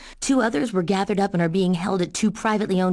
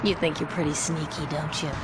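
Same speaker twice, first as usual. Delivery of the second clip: teasing; 3 semitones lower, 6 dB quieter than usual. Acted. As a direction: purr it at him like you caught him out. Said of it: "enough teasing".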